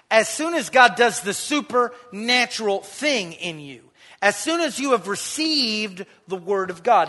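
loud, ringing preacher's declaration; full-force preaching. Acted. Preacher proclaiming God's power to the congregation, loud. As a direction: drive it home to the whole church.

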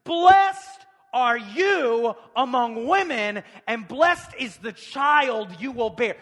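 slow, high, ringing proclamation; loud exclamation. Acted. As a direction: cry the blessing out at full voice. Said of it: "she said in a loud voice".

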